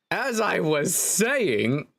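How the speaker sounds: high, very slow, sing-song resumption; trial narrator voice. Shown as a demonstration. Narrator resuming the tale after a cough, high drawn-out voice. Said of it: "I need to give a separate voice for myself".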